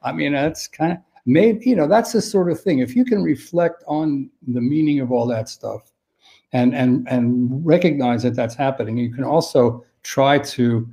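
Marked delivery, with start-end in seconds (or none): none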